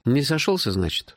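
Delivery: high-pitched, dim-witted echo; dumbly repeating. A narrator's reading. Hero echoes his friend's explanation, dumbly puzzled. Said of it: "тупо повторил я".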